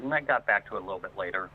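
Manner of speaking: usual speaking voice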